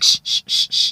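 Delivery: loud repeated shh; urgent shushing. Retold or imitated. Waiting room hushes Veronica, urgent shushing. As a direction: hush someone fast and hard. Acted hushing of a loudmouth.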